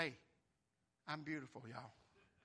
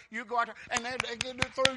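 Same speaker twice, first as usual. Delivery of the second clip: ordinary delivery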